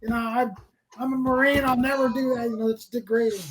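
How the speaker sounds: a touch of wounded pride; proud old marine. Retold or imitated. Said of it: "he's just the guy".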